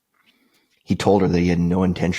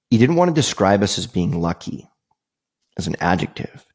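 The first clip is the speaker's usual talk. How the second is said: ordinary talk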